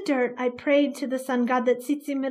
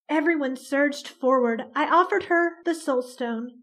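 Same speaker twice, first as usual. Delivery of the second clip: ordinary delivery, nothing marked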